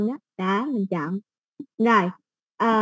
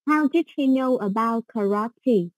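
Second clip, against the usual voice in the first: slow model reading; slower than usual. Shown as a demonstration. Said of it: "Cái chữ này mình không phải đọc là karate".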